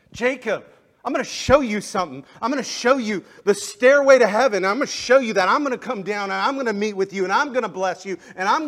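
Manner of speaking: loud, high, impassioned preaching; shouting sermon climax; full-force preaching. Acted. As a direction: preach it out with rising fire.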